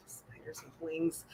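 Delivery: breathless whisper of disgust; horrified whisper. Real, not acted. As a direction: whisper it as if the thought is too awful to say. Horrified at winged spiders.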